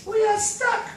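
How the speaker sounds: very high, squeaky fish voice; tiny fish crying for help. Acted.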